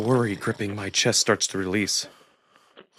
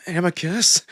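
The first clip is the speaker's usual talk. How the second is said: urgent call; 8 semitones higher, 3 dB louder, slower than usual. Acted. Shaking him awake again.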